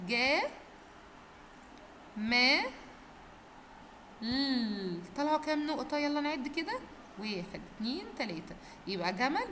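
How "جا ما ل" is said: "جمل" broken into "جا، ما، ل", each part held, long pauses between; slow sounding-out. Shown as a demonstration. Teacher leading pupils to say it together, slowly sounding out "جمل". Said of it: "هننطق بالراحة أنا وأنتوا مع بعض".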